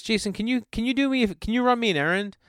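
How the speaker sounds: high-pitched, flustered request; imitating a panicking substitute teacher; panicked teacher. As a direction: stumble over it in a high panicked voice. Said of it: "like, she panicked".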